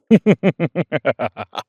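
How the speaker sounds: loud, high laugh tumbling downward; long, high peal of laughter; hearty laugh. Acted. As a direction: burst out laughing, falling in pitch. Character laughs while coaxing companion along.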